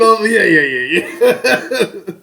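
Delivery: high, loud yeahs through real laughter; laughing hard. Real, not acted. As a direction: crack up while agreeing. Cracking up at the show.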